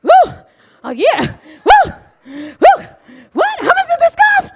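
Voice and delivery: high, incredulous gasps of shock; shocked gasping. Retold or imitated. Wife reacting to the perfume's price, shocked high gasps. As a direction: gasp at the price in disbelief. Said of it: "my husband will tell you, I was".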